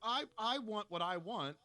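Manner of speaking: imitated entitled insistence. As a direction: insist like a spoiled admirer, put on.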